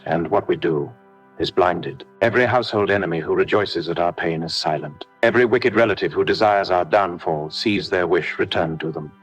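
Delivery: normal talking voice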